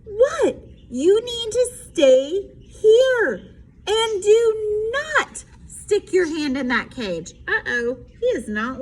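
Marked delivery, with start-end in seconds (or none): 0.0-7.3 higher, louder, slow scold. A narrator's reading. Mom scolding Froggy at the cage, stern. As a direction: lay down the rule.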